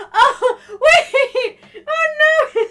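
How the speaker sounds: high, loud laughing oh no; laughing alarm. Real, not acted. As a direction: crack up in mock panic. Laughing reaction to chat.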